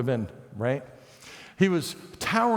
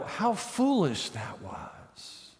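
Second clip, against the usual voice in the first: mocking scoff; 5 dB quieter, much slower than usual. Mocking hindsight voice.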